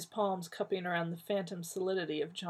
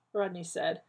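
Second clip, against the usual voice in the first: usual speaking voice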